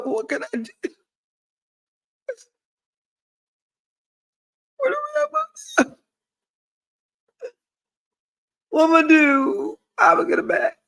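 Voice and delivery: desperate pleading. Retold or imitated. Asking viewers how to win him back, high desperate pleading. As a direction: beg for help as if panicking. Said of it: "I asked y'all".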